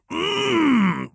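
high pleased hum; a short content mm. Acted. Lucien humming in pleasure. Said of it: "Lucien hummed".

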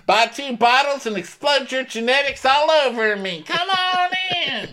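very high hawker voice; pitched-way-up barker pitch; street barker. Retold or imitated. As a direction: lure passersby inside with a laugh. Mock street barking.

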